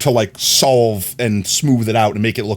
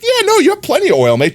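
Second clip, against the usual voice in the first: much higher, cheery put-on voice; voicing the oil gauge; 4 dB louder than usual. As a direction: give the gauge a chirpy silly voice. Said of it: "it's like".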